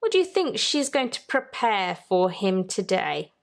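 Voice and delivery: plain reading voice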